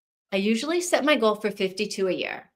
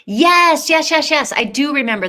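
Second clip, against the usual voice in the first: much higher and louder, rapid yeses of recognition; excited recognition; 6 semitones higher, 10 dB louder than usual. Real, not acted. Suddenly remembering a book she read, excited yeses. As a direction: burst out as it all comes back to you.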